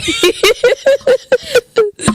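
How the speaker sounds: long high-pitched laugh. Real, not acted. Laughing at her memory of his teasing, high laugh. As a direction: burst into a high giggle.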